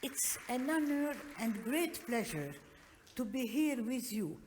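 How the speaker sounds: ordinary talk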